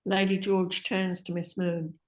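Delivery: ordinary delivery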